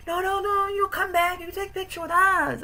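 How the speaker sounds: very high-pitched, insistent put-on voice; imitated schoolgirls. Japanese students calling him back for a picture, high mock pleading. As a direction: mimic excited students in a squeaky register.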